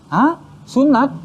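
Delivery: imitated startled dad, pitch jumping high; re-enacted incredulous question; shocked disbelief. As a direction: react as a father caught off guard. Father shocked at the news.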